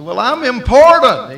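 high, loud self-important objection; indignant protest. Retold or imitated. Prodigal son objecting to his new boss, indignant. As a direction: protest with the pitch leaping up. Acted protest at pig-feeding job.

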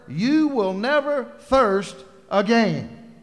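loud, high, slowing preacher's climax; preacher voicing God, swelling to a roar; preaching at full force. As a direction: thunder God's words, building to the last line. Quoting God, rising to climax.

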